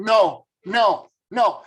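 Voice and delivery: loud, anguished no no no, pushing it away; retold scream. To her client at a tarot reading, horrified loud shouting. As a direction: cry out in horror refusing the cards. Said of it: "I started screaming and saying".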